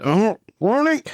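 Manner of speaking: high pompous cry of the name; Rooney voice. Retold or imitated. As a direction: ham up a stuffy father. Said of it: "when he's pretending to be, uh, Sloane's father".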